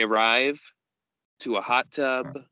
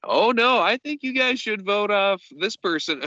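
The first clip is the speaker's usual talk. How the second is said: much higher-pitched mimicking voice; mock meddling bystander; 9 semitones higher than usual. Host imitates the massage therapist giving her two cents, mock earnest. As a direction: chime in with high-voiced mock concern.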